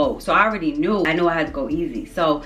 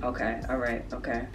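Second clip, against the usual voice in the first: softly steadying yourself, low and quieter; quiet self-reassurance; 5 semitones lower, 9 dB quieter than usual. Retold or imitated. Nervous smoker telling herself it's fine, quiet self-reassurance. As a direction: murmur it low, as if keeping yourself calm. Calming herself as high builds.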